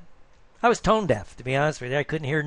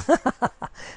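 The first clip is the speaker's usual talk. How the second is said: hearty, higher-pitched chuckle; amused laugh; 10 semitones higher than usual. Real, not acted. Coach joking about his old singing, amused laugh. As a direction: break into a run of laughter.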